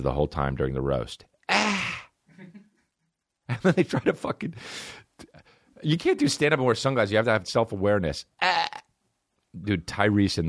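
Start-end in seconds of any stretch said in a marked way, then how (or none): 1.5-2.0 frustrated high yelp. Real, not acted. Frustrated outburst. 8.4-8.7 frustrated high yelp. Real, not acted. Frustrated outburst.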